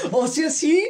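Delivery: high, loud laughing reply. Real, not acted. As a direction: laugh through the words. Laughing at the teasing.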